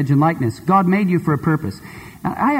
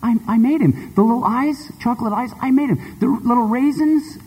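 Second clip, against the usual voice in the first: put-on higher, insistent little girl; much higher girl voice, pleading; 7 semitones higher than usual. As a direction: beg the shopkeeper for him. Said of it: "she says".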